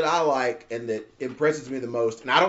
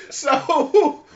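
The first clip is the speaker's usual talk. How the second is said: loud laugh; 11 semitones higher, 5 dB louder than usual. Real, not acted. Big laugh.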